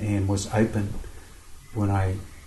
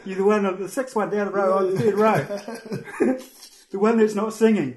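mock God calling out; 11 semitones higher, slower than usual. Mock God picking him out.

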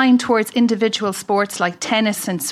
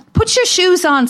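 exasperated nagging; 7 semitones higher than usual. Retold or imitated. Nagging son about shoes.

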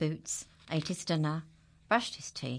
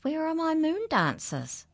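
much higher, dreamy question; moon's voice; 12 semitones higher, 3 dB louder than usual. Acted. The moon asking for its dancers, high and dreamy. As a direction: ask like the moon calling.

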